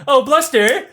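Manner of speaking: high, loud coquettish cooing with a laugh; flirty empress. Retold or imitated. Empress greeting her favourite, high and giggly. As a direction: coo his name like a giddy queen. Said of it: "She's like".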